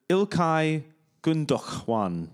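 slow Gundogan in a foreign accent, goon-DOK-wan; put-on weird accent. Shown as a demonstration. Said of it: "I'm putting this weird accent on".

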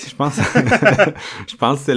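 words broken up by real laughter; laughing. Real, not acted. Host closing the show after a sponsor joke, laughing. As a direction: crack up while trying to wrap up.

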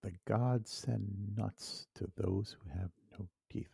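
very soft, low murmur; musing to oneself. Real, not acted. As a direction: mull it over quietly under your breath. Puzzling over the saying to oneself.

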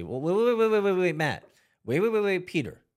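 high, loud whiny protest; mock objecting critic. Podcast host mimicking critics of parents, high whiny protest. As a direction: play the indignant doubter cutting in. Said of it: "for those out there who might say".

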